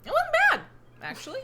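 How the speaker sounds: high, loud surprise at the taste; surprised. Acted. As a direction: blurt it out pleasantly surprised. Fire giant's surprised verdict.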